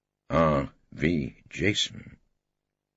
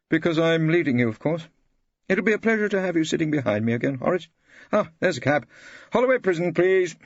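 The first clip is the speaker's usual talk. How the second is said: higher, breezy and pleased with himself; head of chambers breezily telling his junior, higher voice; smug head of chambers; 11 semitones higher, 4 dB louder than usual. Acted. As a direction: announce you're leading, casually superior.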